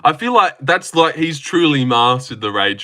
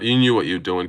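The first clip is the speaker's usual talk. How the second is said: usual speaking voice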